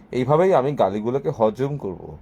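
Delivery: ordinary delivery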